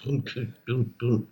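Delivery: soft, low muffled booms; quiet imitation of a thumping beat; mimicked faint bass. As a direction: sound like a sound system turned way down. Showing the weak sound volume.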